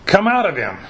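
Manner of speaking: Jesus, stern. A narrator's reading. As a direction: order it out sternly. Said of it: "said Jesus sternly".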